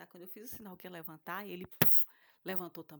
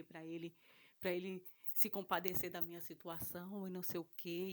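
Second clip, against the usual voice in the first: ordinary talk, nothing marked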